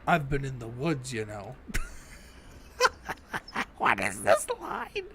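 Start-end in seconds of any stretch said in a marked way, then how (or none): 1.6-3.7 breathless squeaky giggle at the silly line; high cackling laugh. Real, not acted. Player cracking up at weird game dialogue, high giggle. 3.8-5.1 high, slow words squeezed through laughter; laughing. Real, not acted. Player mocking the game's line, laughing high.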